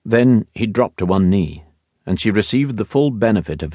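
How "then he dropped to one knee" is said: plain reading voice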